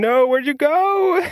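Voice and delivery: high, slow, dismayed cry; as if something just vanished on you; dismayed surprise. Retold or imitated. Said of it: "I was like".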